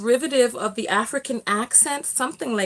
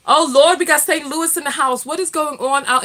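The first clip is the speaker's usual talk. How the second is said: excited shout-out; 3 semitones higher, 7 dB louder than usual. Real, not acted. Shouting out live viewers.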